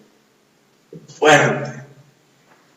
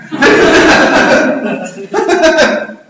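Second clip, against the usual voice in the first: a real laugh, higher; amused real laugh; 10 semitones higher, 4 dB louder than usual. Real, not acted. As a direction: burst out laughing at the joke. Laughs after wife story.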